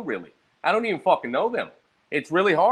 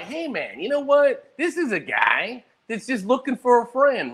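friendly mock voice; 8 semitones higher than usual. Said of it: "It's never like".

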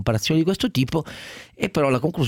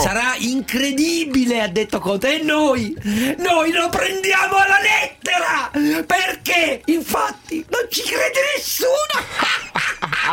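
high, slow shouting through giggles; mock praise cracking into laughter; stifled giggles; slower than usual. Ironic praise breaking into laugh.

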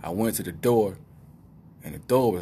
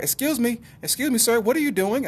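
much higher, prim mock lady voice; 3 dB louder than usual.

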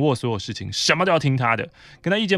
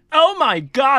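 mock dramatic alarm; 13 semitones higher, 7 dB louder, much slower than usual. Coworker exclaiming at close car.

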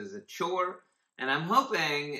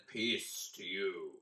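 softer and slower, quietly reassuring; gentle calm greeting; 3 semitones lower, 5 dB quieter, slower than usual. Pretend. Teacher trying Jesus greeting the disciples gently, soft and slow. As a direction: say it softly, like calming frightened friends. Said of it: "Maybe he said".

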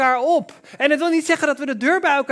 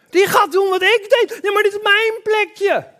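higher, louder protesting voice; indignant jealous voice; 8 semitones higher, 3 dB louder than usual. Retold or imitated. Preacher voices a jealous believer guarding his spot, indignant. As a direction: whine that this is my spot.

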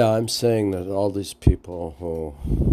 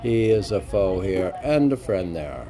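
raised, lilting verse reading; poem recital; 7 semitones higher, 5 dB louder than usual. Acted. Reading the guru poem aloud for the podcast, lilting recital. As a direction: read the devotional verses with lift.

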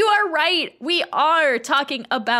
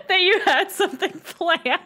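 laughing; 9 semitones higher than usual. Real, not acted. Laughing complaint.

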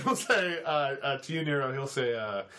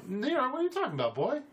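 higher, fast defensive protest; agitated Steven; 6 semitones higher, faster than usual. Acted. GM as Steven snapping back at Nero, fast and high. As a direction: brush it off, too quick and too high.

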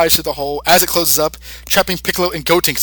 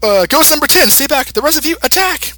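put-on much higher, fast, commanding kid; bossy kid voice; 9 semitones higher, 3 dB louder than usual. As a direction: bark orders like a cocky kid. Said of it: "Gotenks says".

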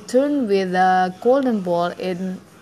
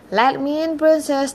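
high-pitched, mild plea; a touch of begging, pitched up; pleading; 6 semitones higher than usual. A narrator's reading. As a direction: plead lightly to be let in.

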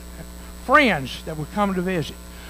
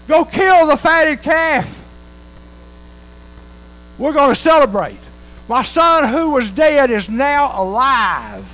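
louder, higher, slow proclaiming order; joyful father's command; 5 semitones higher, 6 dB louder than usual. Acted. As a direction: call it out like a rejoicing father giving orders. Father orders servants to celebrate.

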